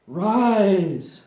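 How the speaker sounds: loud, pleading command to rise. Shown as a demonstration. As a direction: call it up like a spell.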